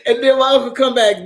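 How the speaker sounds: normal talking voice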